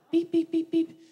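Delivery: high, even electronic beeps; imitated detector beeps. Comic retelling airport security to an audience, imitated alarm beeping.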